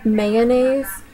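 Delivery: usual speaking voice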